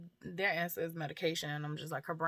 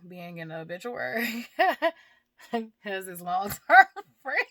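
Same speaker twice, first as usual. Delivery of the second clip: real laughter mid-sentence, louder toward the end; laughing in disbelief; 2 semitones higher, 10 dB louder than usual. Real, not acted. A woman recalls being listed as long-term friend, laughing in disbelief. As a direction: laugh breaking through the words, pitch climbing. Wry laugh at obituary label.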